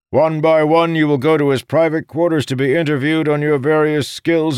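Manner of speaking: loud, high-pitched official announcement; booming voice. A narrator's reading. First officer briefing the expedition aboard ship, booming. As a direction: project every word to a full room. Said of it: "in a booming voice".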